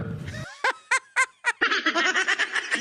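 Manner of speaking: long high giggle; long laugh. Real, not acted. Vlogger cracking up at the secretary's face, long high laugh. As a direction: crack up at the clip.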